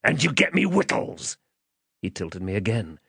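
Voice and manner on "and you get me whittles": higher, threatening command; menacing man. Acted. Man ordering the child as he tilts him, menacing. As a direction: lean on each demand to frighten the child.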